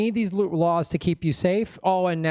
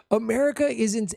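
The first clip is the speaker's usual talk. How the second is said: normal talking voice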